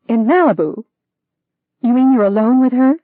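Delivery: high, loud incredulous "In Malibu?"; shocked disbelief. Acted. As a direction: throw his words back in shock.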